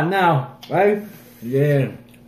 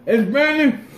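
surprised; 11 semitones higher, 4 dB louder, slower than usual. Real, not acted. Reacting to a burning mouth.

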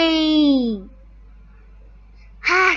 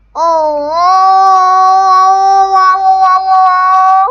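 excited wows; 4 semitones higher, 9 dB louder, much slower than usual. Real, not acted. Amazed by the game scene.

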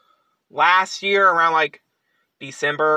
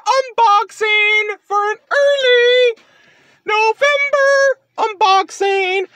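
high, drawn-out unboxing announcement; hyped intro announcement; 13 semitones higher than usual. Real, not acted. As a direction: stretch it out high like a show opener. Opening announcement of the unboxing video.

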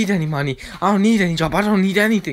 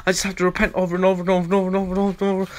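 very fast run of "over and over"; rattled off; much faster than usual. Acted. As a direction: fire off the repeats breathlessly fast. Rapid repetition of over.

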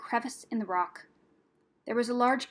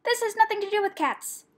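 high, loud, indignant snap; cross rat's sharp retort; angry hiss; 11 semitones higher, 5 dB louder than usual. A narrator's reading. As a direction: snap back angrily, pitch way up. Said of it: "hissed Rat angrily".

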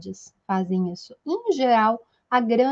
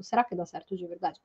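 doubtful to self; 4 semitones lower, 8 dB quieter, faster than usual. Retold or imitated. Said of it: "Aí toda vez eu fico".

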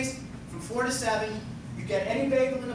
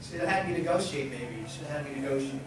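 normal talking voice

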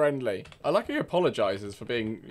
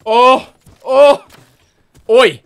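loud call out; 9 semitones higher, 15 dB louder than usual. Real, not acted. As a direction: shout across the map, pitched up. Calling out to another player.

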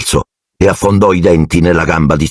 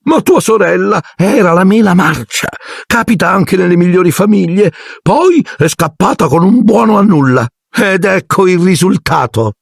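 aunt Marge voice; 13 semitones higher than usual. A narrator's reading. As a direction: look down your nose at the boy's family. Aunt voiced in read-aloud story.

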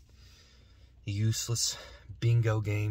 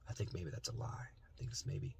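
unvoiced whispered aside; 6 semitones lower, 19 dB quieter than usual. Real, not acted. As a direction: breathe it out like a secret. Whispered aside about the slogan.

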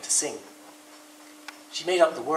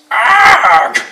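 very loud, high startled yell; startled shout; 10 semitones higher, 19 dB louder than usual. Acted. Rabbit startled by Woodpecker's banging, a loud high yell. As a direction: jolt out a shriek of fright. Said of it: "Rabbit jumped twice his own height".

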